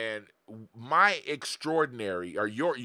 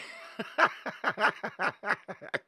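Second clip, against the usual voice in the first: breathy laugh; 7 semitones higher than usual. Real, not acted. Laughs at Jamie's comment.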